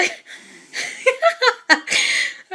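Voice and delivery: high, unvoiced burst of laughter; high breathless laugh. Real, not acted. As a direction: let the laugh squeak out breathlessly. Laughs at Bakugo name.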